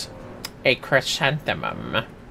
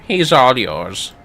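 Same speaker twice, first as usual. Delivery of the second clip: normal talking voice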